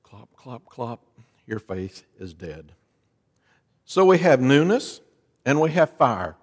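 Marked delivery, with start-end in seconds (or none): 0.0-1.0 quiet mouth-made hoofbeat clops; faint imitated clop clop clop; soft clopping sounds. Imitated sound between repetitions. 1.5-2.7 hushed fade-out. Acted. Fading final repetition.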